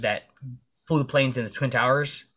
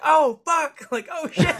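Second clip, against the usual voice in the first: much higher and louder, squeaky mock panic; panicking; 4 dB louder, slower than usual. Caught trespassing, squeaking in mock panic. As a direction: yelp like you just got caught. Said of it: "I was, like, panicking".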